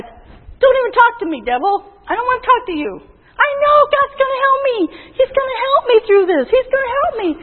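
high, insistent rebuttal; defiant, emphatic declaration of trust; rebuking the devil. Acted. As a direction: tell the devil off with firm faith. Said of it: "you've got to say it to the devil".

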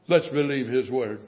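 ordinary talk

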